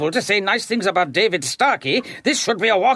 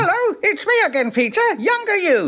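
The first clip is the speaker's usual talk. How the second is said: higher, sing-song voice for the dummy; ventriloquist dummy voice; 10 semitones higher than usual. Acted. Therapist voicing a dummy to Peter, higher and cheery. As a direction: play the dummy as his younger self, cheerfully. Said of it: "imagine this dummy is your younger self".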